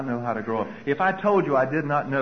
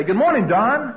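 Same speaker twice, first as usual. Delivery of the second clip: bright greeting; 6 semitones higher, 5 dB louder, slower than usual. Retold or imitated. Greeting the boss.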